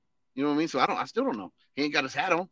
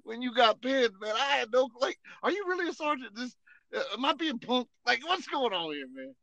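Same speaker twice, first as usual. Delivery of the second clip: higher, disbelieving run of questions; incredulous protest; 9 semitones higher than usual. Retold or imitated. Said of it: "And I was like".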